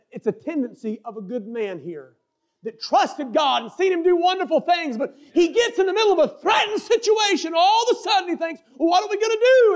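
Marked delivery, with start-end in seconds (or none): 2.6-8.6 high-pitched, building sermon delivery; impassioned pulpit storytelling; preaching. Acted. Preaches Asa's sudden panic. 8.8-9.7 high-pitched mock panic; preacher's hammed-up fretting; panicked quote. Voices Asa's panic under threat.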